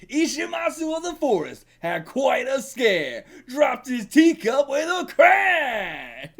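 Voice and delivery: loud, slow, over-the-top storytelling shout; booming hammed-up singer. A vain performer bellows his old tale at the audience, loud and slow. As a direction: belt it out like a vain stage singer. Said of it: "He's loud, and he's giving a hell of a performance".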